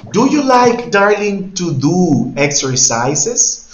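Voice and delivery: high, loud start, then very slow, drawn-out finish; teacher asking a student slowly; slow loud question. Shown as a demonstration. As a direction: stretch the question for a learner. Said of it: "teacher asks student slowly".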